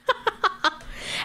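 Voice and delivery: short high laugh at the absurdity; high breathy laugh. Real, not acted. Laughing at the rude woman's retort while telling viewers.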